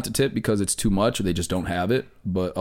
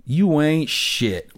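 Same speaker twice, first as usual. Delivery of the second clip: very slow, higher, drawn-out taunt; mocking put-down; 7 semitones higher, much slower than usual. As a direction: imitate a buddy dragging out an insult. Buddy's put-down acted out.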